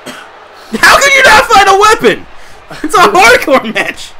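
high, slow, exaggerated disbelief; mock outraged commentator. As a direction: play up the outrage like a ringside announcer.

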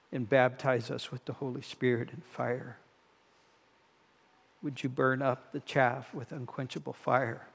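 soft, hushed pastoral prayer, fading to near silence; earnest prayer. Real, not acted. Pastor praying for his congregation, softly earnest. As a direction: pray it quietly to God, hushed. Pastor prays aloud to God.

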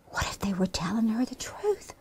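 hushed storyteller whisper; worried whisper. A narrator's reading. Storyteller voicing Henrietta's worry at bedtime, whispering. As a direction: whisper the nagging doubt.

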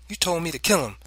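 firm, high-pitched comeback; positive counter. A narrator's reading. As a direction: counter father with certainty. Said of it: "I countered positively".

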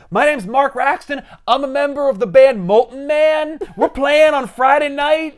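high, loud hyped promo voice; goofy band plug. Retold or imitated. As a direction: sell the gig like a dopey teen. Kid plugs his band loudly.

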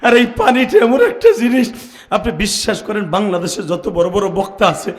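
loud preaching building to a high wail; impassioned tearful preaching. Real, not acted. Motherless preacher confessing to the crowd, tearful and impassioned.

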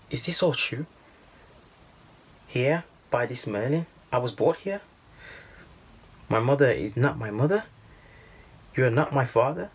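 put-on a boy begging his father for the truth; shocked young boy. A narrator's reading. Boy asks father if it is true, stunned and pleading.

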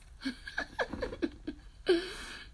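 high, airy laughing fit; real amused laugh. Real, not acted. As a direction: laugh helplessly at a comment. Amused by comments on the spelling.

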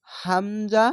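ordinary talk

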